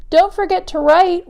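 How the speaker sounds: calling out. Acted. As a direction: call it out to the one driving off.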